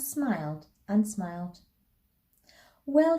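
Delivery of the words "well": high, loud, happy "well"; happy relief. A narrator's reading. Mother to her children after the border, happily relieved. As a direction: let it lift out with relief.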